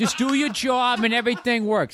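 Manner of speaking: put-on high, laughing Boston accent. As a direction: preach it like a Boston guy. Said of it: "that's Midwest".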